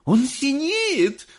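high, drawn-out cry of horror; very high, stretched shriek; straining scream. A narrator's reading. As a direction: wail in alarm as a mother. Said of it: "надрывалась миссис Боригард".

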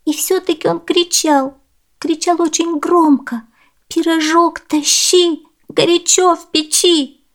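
put-on high child's voice, slowing into a chant; insist, then chant the pie's cry slowly; high-pitched stubborn girl; little girl insisting. Girl quotes the pie insistently.

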